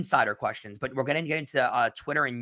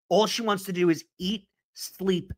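ordinary delivery

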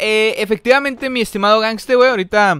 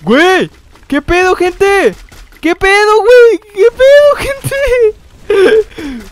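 very high, loud shriek of disbelief; shocked screaming; 13 semitones higher, 11 dB louder, much slower than usual. Real, not acted. Streamer screaming to his viewers about a game shock, shocked screaming.

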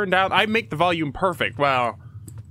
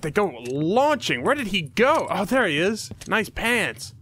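ordinary talk

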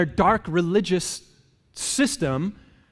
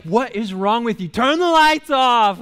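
exasperated wife; 6 semitones higher, 5 dB louder than usual. Retold or imitated. Wife annoyed at grumpy husband, higher and louder. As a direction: throw his words back at him. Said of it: "She's like".

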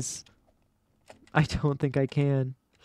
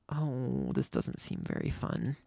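under-breath mutter; 13 dB quieter than usual. Real, not acted. Player muttering after failed jump.

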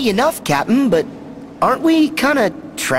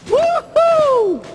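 excited whoop; 12 semitones higher than usual. Acted. Thrilled at seeing new ship.

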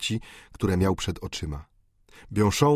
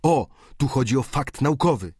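higher, louder with a newcomer's zeal; eager exclamation; 6 semitones higher, 4 dB louder than usual. A narrator's reading. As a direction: exclaim it like a thrilled novice. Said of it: "zawołał student medycyny z całym zapałem neofity". (zapalony okrzyk studenta medycyny)

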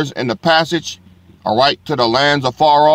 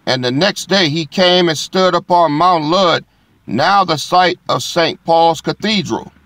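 preacher proclaiming scripture to the congregation; scripture reading; 4 semitones higher than usual. Acted. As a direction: lift the verse like a proclamation. Reads scripture aloud to congregation.

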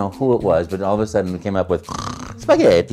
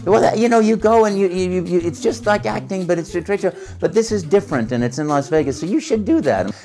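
friend explaining; 10 semitones higher than usual. Retold or imitated. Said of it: "a friend of mine calls up and says".

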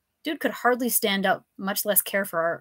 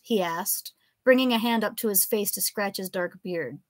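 ordinary delivery

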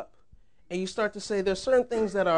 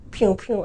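toy gun noises. Retold or imitated. Recalling solo play with little action figures, imitated pew-pew shots. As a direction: mimic a kid firing toy blasters.

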